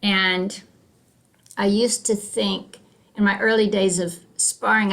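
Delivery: usual speaking voice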